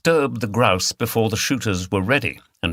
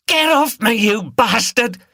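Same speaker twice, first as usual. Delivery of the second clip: screamed; 13 semitones higher, 5 dB louder than usual. A narrator's reading. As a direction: scream it as he grabs you. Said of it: "she screamed".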